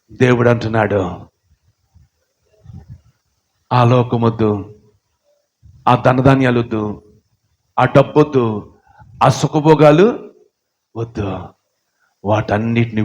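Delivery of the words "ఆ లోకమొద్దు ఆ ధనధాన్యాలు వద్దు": ordinary talk, nothing marked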